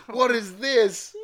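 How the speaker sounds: high, loud, drawn-out exclamation; mock horror. Reader voices Severus gazing at the monstrosity, mock horror. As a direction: stretch it out in exaggerated horror. Horrified character reacts to the sweater.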